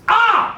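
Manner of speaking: short, high startled cry; startled yelp. Acted. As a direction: jolt awake with a yelp. Acts out waking up broke.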